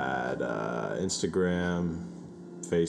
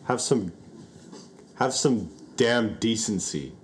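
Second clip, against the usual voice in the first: mock-stern scolding, raised; aggressive; 5 semitones higher than usual. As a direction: scold them with mock outrage. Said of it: "why I'm being so aggressive".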